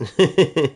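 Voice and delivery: quick, high giggle; brief amused chuckle in bursts; short laugh. Real, not acted. Streamer amused at his misheard lyrics, giggling.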